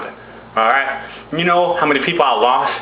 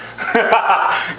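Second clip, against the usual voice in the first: high put-on chuckle; short, high mock laugh; acted laugh; 7 semitones higher than usual. God laughs again, acted.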